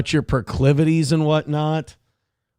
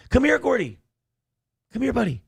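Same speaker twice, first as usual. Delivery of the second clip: high coaxing call to the dog; 11 semitones higher, faster than usual. Real, not acted. Owner coaxing Gordy the dog over, high call. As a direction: coax the dog over, pitched up.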